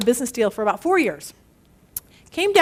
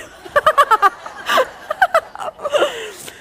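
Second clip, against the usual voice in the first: long, high peal of laughter; real laughter; 10 semitones higher than usual. Real, not acted. Speaker tickled by the pile of hay joke, laughing hard. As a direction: crack up at your own punchline.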